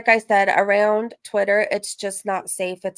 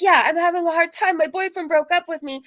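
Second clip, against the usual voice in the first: retold reply, pitched up; lightly voiced upset woman; 8 semitones higher than usual. Crying woman to catcaller, lightly acted higher-pitched reply. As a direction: give her answer in a higher voice. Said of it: "And she's like".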